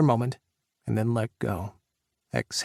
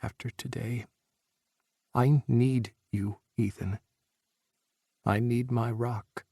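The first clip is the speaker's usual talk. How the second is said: tender plea. A narrator's reading. Jack pleads softly.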